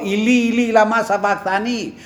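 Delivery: crying out. Retold or imitated. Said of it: "Ο ίδιος φώναζε πάνω στο σταυρό".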